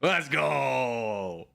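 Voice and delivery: celebrating a near-miss guess; excited cheer. Real, not acted. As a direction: cheer through the laugh. Cheers after close guess.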